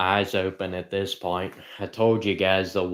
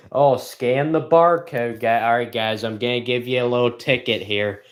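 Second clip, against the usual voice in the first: ordinary talk, nothing marked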